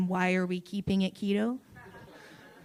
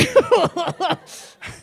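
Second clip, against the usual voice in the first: high, loud burst of laughter; 10 semitones higher, 6 dB louder than usual. Real, not acted. Comic apologising for the lie, bursts out laughing. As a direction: crack up at your own line.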